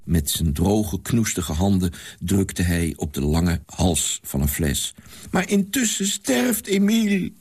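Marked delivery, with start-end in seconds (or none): 5.3-7.3 put-on high, urgent girl's cry; girl calling out. A narrator's reading. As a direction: call out in fear for her brother. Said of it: "riep het meisje". (hoge, angstige meisjesroep)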